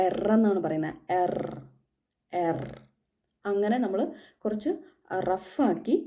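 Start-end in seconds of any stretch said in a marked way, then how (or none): 1.2-2.6 "എർ" with a rough throaty r, not silent as in English; hard German r. Shown as a demonstration. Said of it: "കുറച്ച് ഹാർഡായിട്ട് പറയുക".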